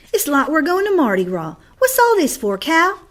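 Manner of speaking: put-on high, loud excited little girl; a young girl puzzled by Sunday fuss; child voice. A narrator's reading.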